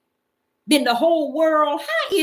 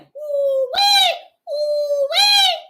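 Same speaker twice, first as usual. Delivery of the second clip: ooh-wee exclamation; 13 semitones higher, much slower than usual. Real, not acted. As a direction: let out a high excited whoop. Preacher's exclamation after verse.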